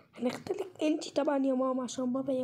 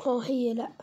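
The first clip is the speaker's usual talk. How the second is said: whisper; 4 semitones lower, slower than usual. Acted. Secret confirmation to friend.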